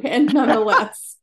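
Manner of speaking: a giggly aside after thanking him; laughing. Real, not acted. Guest laughs off the host's compliment, laughing.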